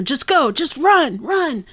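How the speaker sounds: high-pitched mock alarm; urgent warning. Sokka urges everyone to flee.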